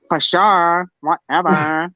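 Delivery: put-on high, airy Valley Girl drawl on whatever. Describing Californians to a friend, Valley Girl voice. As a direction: toss it off like a bored Valley Girl. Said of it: "California has the Valley Girls".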